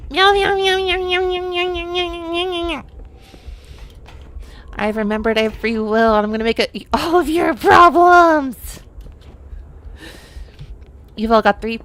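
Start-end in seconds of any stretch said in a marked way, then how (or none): 0.1-2.9 high meowing, fading out; nervous cat voice. Pretend. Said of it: "I'm a cat". 6.7-8.8 high, loud playful threat; mock villain cat.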